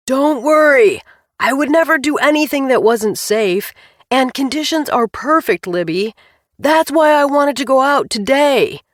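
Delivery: husband shouting over the engine; loud and reassuring, calling over noise; yelling. A narrator's reading. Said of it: "Shiloh yelled".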